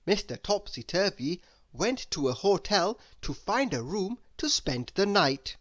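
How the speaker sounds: ordinary delivery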